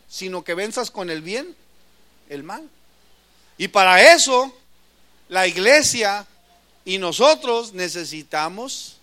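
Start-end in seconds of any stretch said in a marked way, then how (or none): 3.6-9.0 forceful preaching. Real, not acted. Preaching climax.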